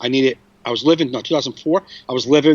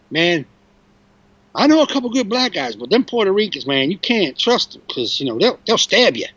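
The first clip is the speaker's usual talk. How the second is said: higher-pitched grandfather voice, retold; imitated grandfather; 5 semitones higher than usual. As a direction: quote your grandfather with animated conviction. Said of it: "I remember my grandfather saying".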